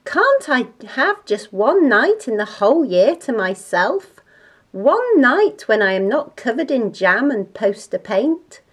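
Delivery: exasperated mother's rant. Acted. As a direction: rant with heavy punches on key words.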